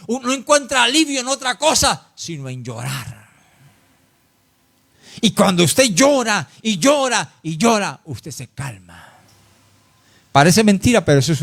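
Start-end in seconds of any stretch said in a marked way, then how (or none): 2.1-3.3 soft drop. Real, not acted. Preacher softens on crying's relief. 8.0-9.1 much softer and slower, like settling down. Real, not acted. Preacher softens on calming down.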